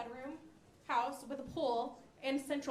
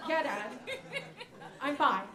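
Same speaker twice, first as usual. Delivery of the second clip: louder, exasperated yeah dad; weary reassurance; 8 dB louder than usual. Retold or imitated. Daughter answering worried dad on phone, loud exasperated brush-off. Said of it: "answering dad on phone".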